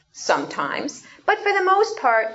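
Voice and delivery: ordinary talk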